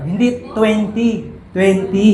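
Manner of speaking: slow corrected "twenty"; wife's correction. Retold or imitated. Wife correcting how he says twenty. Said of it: "kinokorek ng ganun".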